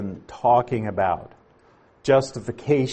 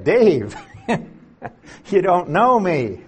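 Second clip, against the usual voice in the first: laughing reply; 5 semitones higher than usual. Retold or imitated. Retelling his reply to Dave.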